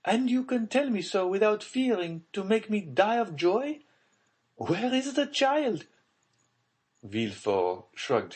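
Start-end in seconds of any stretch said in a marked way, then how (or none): 0.0-5.9 Baroness voice. Acted. Baroness demanding of Villefort about her buried child, high and frightened. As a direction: pitch her up high, a mother in dread.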